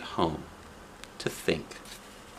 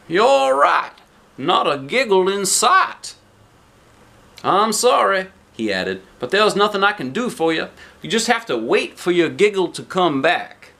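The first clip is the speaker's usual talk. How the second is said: higher and louder, gently regretful; sorry doctor; 7 semitones higher, 4 dB louder than usual. Acted. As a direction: break the news kindly. Doctor voiced.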